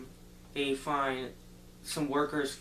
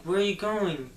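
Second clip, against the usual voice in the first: raised, higher imitation of a call; calling after someone; 5 semitones higher, 6 dB louder than usual. Girl chasing him for her compass, calling after him.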